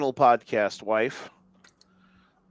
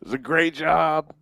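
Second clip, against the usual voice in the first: Trump impression; 3 semitones higher, 4 dB louder than usual. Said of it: "I need to hear a Trump speech with that effect on".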